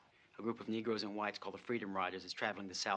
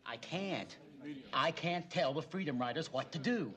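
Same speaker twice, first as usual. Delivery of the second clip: raised, higher pitched protest; angry; 7 semitones higher than usual. Acted. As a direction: push back hard at your father. Heated argument with father.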